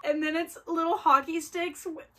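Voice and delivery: high squeal breaking into a shout; freaking out. Real, not acted. Vlogger unwrapping fan gifts, squealing excited shout. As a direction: lose it with delight. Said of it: "This is me freaking out".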